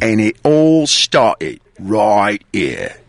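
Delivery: very slow, low British accent. Shown as a demonstration. Host modelling the film's line for Hutch, British accent. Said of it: "very good British accent".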